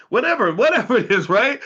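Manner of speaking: high and loud, laughing through the words; cracking up at his own silly example. Real, not acted. Podcast host laughing at his own made-up CEO pitch.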